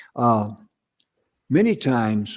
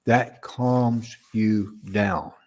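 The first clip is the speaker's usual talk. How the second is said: slowed speech; much slower than usual. Shown as a demonstration. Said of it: "pronounce your words phonetically".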